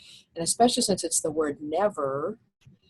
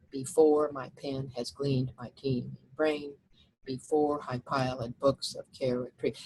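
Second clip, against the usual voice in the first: reading verse aloud; 3 semitones lower, 4 dB quieter than usual. Shown as a demonstration. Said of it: "look at the first, first line".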